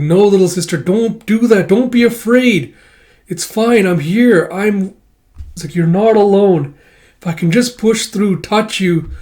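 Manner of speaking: comforting plea. Acted. Kippa comforting scared sister.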